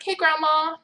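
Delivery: normal talking voice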